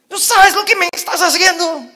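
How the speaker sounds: high, loud, half-laughing scold; frantic parent yelling. Retold or imitated. Parent shouting at the child found after an hour, high frantic yell.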